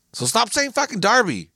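high-pitched comic indignation; exasperated outburst. Real, not acted. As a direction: plead it loudly, at wit's end. Climax of the Derby rant, then laughs.

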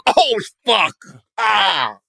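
high, choked mock sob of a moved heckler; fake-weep it, overcome by the joke; a hammed-up tearful gasp; mock crying. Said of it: "And he's, like, crying".

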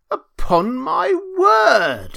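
high, loud, drawn-out outburst; indignant exclamation. A narrator's reading. Miller finding Hans still in bed, indignant exclamation.

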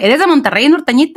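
high, lilting Monterrey-style question; teasing norteño accent. Retold or imitated. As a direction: tease with a mock norteña singsong. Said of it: "but they're like".

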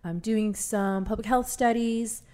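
normal talking voice

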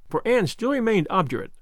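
plain reading voice

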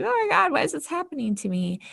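high, put-on self-pitying voice; mock victim wail. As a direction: whine like a helpless victim. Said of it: "I just fell into complete victim mode".

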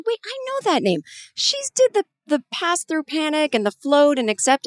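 high and eager, it clicks; suddenly recognizing a name mid-interview; high, quick excited realization; excited recognition. Retold or imitated. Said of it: "And I said".

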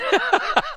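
a real burst of laughter, high. Real, not acted. Host hearing the clip, high burst of laughter.

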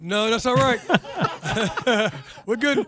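high, loud laughing refusal. Real, not acted. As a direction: wave it off through a laugh.

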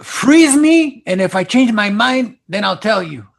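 acted interruption. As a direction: break in loudly as the haggling actor. Acting out actor interrupting negotiations.

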